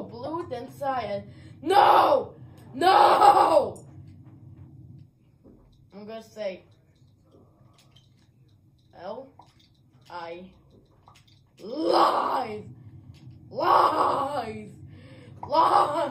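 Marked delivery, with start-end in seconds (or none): 1.6-3.8 loud desperate yell; shouting no. Real, not acted. Gamer yelling at teammates as they vote, shouting. 11.6-16.1 wailing denial, loud; crying lies. Real, not acted. Accused gamer wailing that it's all lies.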